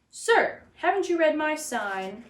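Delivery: high, loud indignant shout. A narrator's reading. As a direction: shout it up at the fox. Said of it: "Doctor DeSoto shouted".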